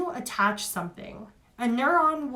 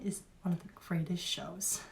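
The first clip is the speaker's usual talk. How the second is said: whisper; 3 semitones lower, 8 dB quieter than usual. Real, not acted. Aside about favourite show.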